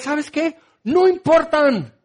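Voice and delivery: usual speaking voice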